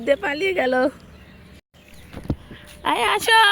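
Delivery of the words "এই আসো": calling out. Real, not acted. Calling child back.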